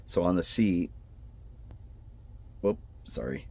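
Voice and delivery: normal talking voice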